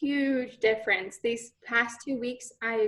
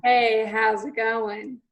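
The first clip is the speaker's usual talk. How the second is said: slow, louder chesty greeting; chest voice; 5 dB louder, slower than usual. Shown as a demonstration. Student echoing teacher's exercise, slow chest voice. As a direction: copy it slowly from the chest. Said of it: "hand on their chest and go".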